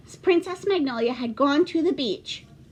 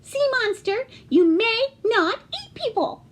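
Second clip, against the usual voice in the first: much higher firm heroine voice; commanding princess; 11 semitones higher, 4 dB louder than usual. Acted. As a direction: order the monster firmly. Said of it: "She said".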